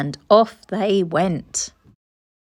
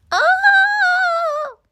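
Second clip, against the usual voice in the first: long, very high, loud wail; crash cry; 6 dB louder than usual. Acted. Missus Armitage crashes her overloaded bike, long high loud cry. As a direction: cry out as you tumble off.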